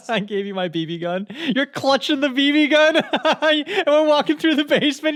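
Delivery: helpless high laughter through the words; squealing with laughter; laughing teasing. Real, not acted. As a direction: tease while cracking up, high and loud. Laughing while teasing wife about the gun.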